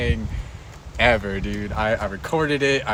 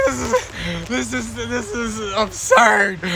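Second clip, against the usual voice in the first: laughing disbelief; 5 semitones higher, much slower than usual. Retold or imitated. Retold reaction, laughing.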